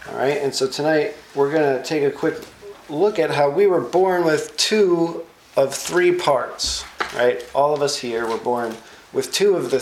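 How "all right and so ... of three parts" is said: normal talking voice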